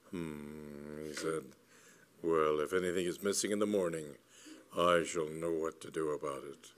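lightly voiced low, wary man; half-convinced explorer. A narrator's reading. Explorer half convinced about a possible theft, low and wary.

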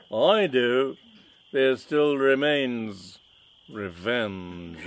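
slow, menacing villain vow; mustache twirling villain. Acted. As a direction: linger on the threat. Villain vows revenge.

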